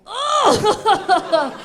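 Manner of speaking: ordinary talk, nothing marked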